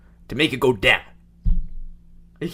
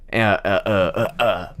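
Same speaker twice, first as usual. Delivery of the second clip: short grunts stepping lower; 5 semitones lower, slower than usual. Shown as a demonstration. Host shows friend how to bring his voice down, lower grunts. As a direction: step each "uh" further down in pitch. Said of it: "You can do".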